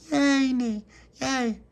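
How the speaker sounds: tiny high squeal of joy; loud high-pitched baby yay; toddler cheer. Acted. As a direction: cheer like a delighted little girl. Toddler cheers at jam butty.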